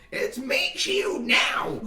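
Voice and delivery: slow, high-pitched insistence; indignant Spork Blade voice. Acted. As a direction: correct them in a drawn-out high squawk. Meat Shield corrects his name indignantly.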